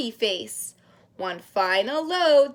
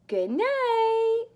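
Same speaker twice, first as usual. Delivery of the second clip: much higher sing-song goodnight; 10 semitones higher than usual. Acted. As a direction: lilt it way up. High goodnight.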